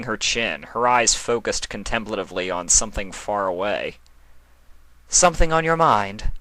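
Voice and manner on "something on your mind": higher, louder regal query; a regal goddess probing gently; Moon Goddess asking. A narrator's reading. Nightmare Moon asks Chrysalis, higher and louder. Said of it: "the Moon Goddess asked".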